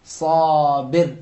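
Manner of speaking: slow صابر with a thick, full saad; teacher models a heavy saad. Shown as a demonstration. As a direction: make the s of صابر heavy and round. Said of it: "سین کو تھوڑا سا بھاری اور پرحجم ادا کریں گے".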